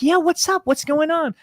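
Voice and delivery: high-pitched, upbeat customer service voice; Aaron voice. Acted. Staffer taking an angry customer's call, put-on customer service voice. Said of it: "put the Aaron voice on".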